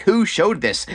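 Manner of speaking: high-pitched acted accusation; mock confrontation. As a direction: demand it, imitating a catfight. Acted fight over the book.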